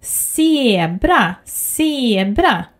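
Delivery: zebra said as sebra. Shown as a demonstration. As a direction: zebra with an S at the start. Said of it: "pronounced with the sound S".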